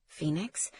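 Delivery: soft rising stunned question. A narrator's reading. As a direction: say her name in disbelief. Father stunned by news.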